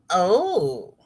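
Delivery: usual speaking voice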